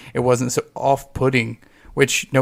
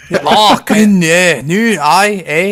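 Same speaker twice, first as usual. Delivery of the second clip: loud, high, garbled mock Scottish mumble; mumbled Scottish mimicry; 6 semitones higher, 9 dB louder, slower than usual. Mocking a hard-to-follow Scottish voice, loud garbled Scottish. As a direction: blur the words like a thick Scottish brogue.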